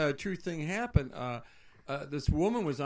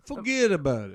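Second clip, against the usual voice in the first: louder "Forget about it", FORget stressed, falling, in a bad Brooklyn accent; fake Brooklyn accent; 2 semitones higher, 5 dB louder than usual. Shown as a demonstration. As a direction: hit the catchphrase with a mock Brooklyn swagger. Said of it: "fugazi impression I've ever heard".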